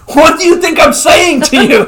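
high, loud, laughing through the quote; a hammed-up freak-out, voice jumping up; mock panicked Dwight. Dwight freaking out at Jim on the phone, high and loud.

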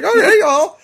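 high, laughing through the words. Retold or imitated. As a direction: laugh it out, amused. Retelling reaction, laughing high.

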